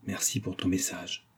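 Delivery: softly murmured thanks; hushed. A narrator's reading. Young man secretly thanking Antonine for her message, hushed. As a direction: say it very quietly, for one listener. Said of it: "dit-il tout bas".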